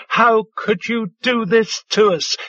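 high anguished scream, a touch hammed. Lover screaming at unfaithful partner, high pitched. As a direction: scream the accusation at her. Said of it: "Djale screamed".

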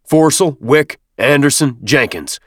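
projected sergeant calling names; booming. A narrator's reading. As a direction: boom the names out one by one. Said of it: "he boomed".

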